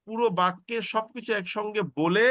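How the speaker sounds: normal talking voice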